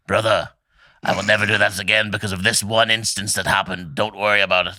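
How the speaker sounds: a hint of a stern soldier brother; Titus impression. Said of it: "Titus would be like".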